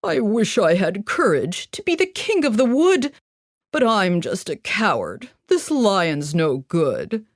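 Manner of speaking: a hint of a timid lion, slowing to the end; lightly voiced cowardly lion.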